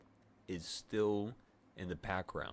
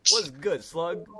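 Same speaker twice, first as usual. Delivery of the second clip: louder, higher, teasing call; 10 semitones higher, 10 dB louder, slower than usual. Real, not acted. Teasing Cody locked outside, loud call. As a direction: call out through the door to him.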